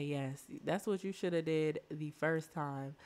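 ordinary talk, nothing marked

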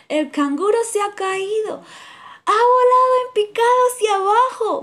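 high, loud sing-song jeer; hummingbird yelling. A narrator's reading. Hummingbird yells about the fall, high and loud. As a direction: holler it with glee. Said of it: "vociferea el colibrí".

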